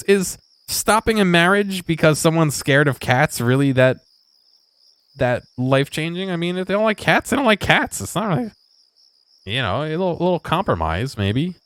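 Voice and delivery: can't believe this is a dealbreaker; incredulous. Real, not acted. Streamer questioning the cancelled marriage, high disbelief.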